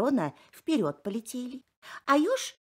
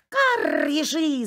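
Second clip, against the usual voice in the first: loud cawing cry; crow yelling; 6 semitones higher, 7 dB louder, slower than usual. Acted. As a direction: scream it like a crow. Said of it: "заорала ворона".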